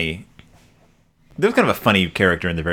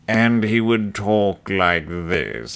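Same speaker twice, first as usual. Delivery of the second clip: very slow, droll drawl; deadpan caricature voice; Alfred Hitchcock impression; much slower than usual. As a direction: stretch each word like a macabre host. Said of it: "he would introduce every episode".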